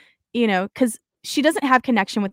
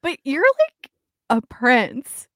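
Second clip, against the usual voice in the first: high, incredulous outburst, a bit louder on the end; mock disbelief; 4 semitones higher, 4 dB louder than usual. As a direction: act floored that his dad has no idea. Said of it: "And I'm like".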